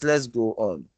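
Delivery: normal talking voice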